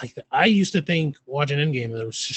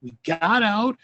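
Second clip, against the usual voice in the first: higher, fast, proud and clueless; Red Guardian boasting; 8 semitones higher than usual. Retold or imitated. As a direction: brag as if the mission went perfectly. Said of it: "he was just like".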